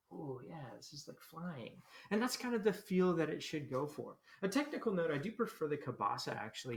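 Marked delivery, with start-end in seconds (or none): 0.1-1.8 soft, slow, carefree wonder; like a hand riding the wind out a window; dreamy delight. Retold or imitated. Teacher voicing the carefree feel, soft and dreamy. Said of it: "It's got this kind of a".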